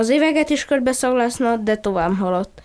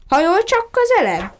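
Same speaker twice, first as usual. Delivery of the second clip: higher, sharp order to lean in; a firm call from a suspicious pup; stern command; 8 semitones higher than usual. Acted. Puppy sternly orders the duckling closer, a stern higher call. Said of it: "szigorúan rászólt".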